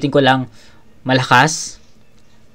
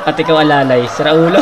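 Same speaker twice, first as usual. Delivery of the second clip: louder, higher, laughing echo of the rapper; amused quoting; 3 semitones higher, 7 dB louder than usual. Retold or imitated. Reviewer echoing the battle rapper's punchline, laughing. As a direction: repeat the punchline while cracking up.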